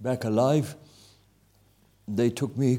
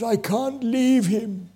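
pleading; 10 semitones higher, 3 dB louder, much slower than usual. Retold or imitated. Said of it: "pulled him begging".

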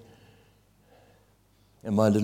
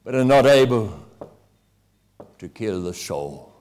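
scripture quoted with force; 3 semitones lower, 5 dB louder, slower than usual. Retold or imitated. Preacher quoting the Bible to a wounded attacker, loud then hushed and slow. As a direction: proclaim it, then let it fall to a slow hush. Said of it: "it says".

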